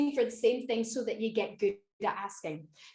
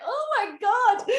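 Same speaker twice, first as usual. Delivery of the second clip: high, slow, laughing "oh my God"; laughing disbelief; 9 semitones higher, 3 dB louder, slower than usual. Retold or imitated. Telling the audience about the beat-up Uber, laughing in disbelief. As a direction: let the disbelief spill into a laugh. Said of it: "I was just like".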